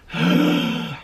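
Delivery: long, low exhausted sigh; weary sigh. Real, not acted. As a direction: sigh out the boredom. Recalling a boring knit.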